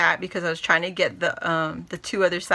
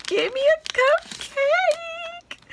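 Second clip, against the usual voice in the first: squealing delight; much slower than usual. Real, not acted. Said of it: "I'm so excited".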